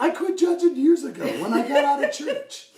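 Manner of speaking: high, loud, emphatic impression; Tom Henry. Tom explaining he left church, high loud voice. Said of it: "with my Tom Henry".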